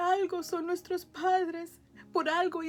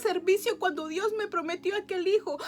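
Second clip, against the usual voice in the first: louder, building to a fervent proclamation; preaching at full force; 3 semitones higher, 7 dB louder than usual. Real, not acted. Declaring to the church her restored marriage, fervent and loud.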